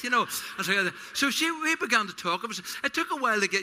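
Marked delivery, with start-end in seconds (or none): none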